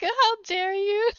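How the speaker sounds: hammed-up scolding; mock outrage. Girlfriend throws sandal at boyfriend, mock outraged.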